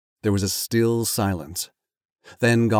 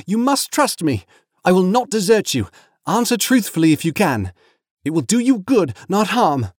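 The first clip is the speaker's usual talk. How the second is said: high, firm reassurance; earnest Frodo; 11 semitones higher, 5 dB louder than usual. Acted. Frodo urges frightened Gollum to answer, earnest high voice. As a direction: urge him to trust you, pitched well up.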